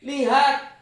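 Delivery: loud, high rebuke; rebuking voice of God. Retold or imitated. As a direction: scold him like a stern master. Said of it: "Suaranya menghardik". (suara Tuhan yang menghardik dengan keras)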